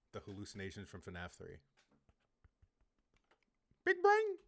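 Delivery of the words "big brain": very high, loud squeak; mock boast. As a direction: squeal it out sky-high as a joke. Mock boast after hallucination remark.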